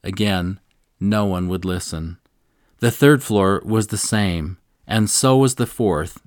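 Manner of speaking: usual speaking voice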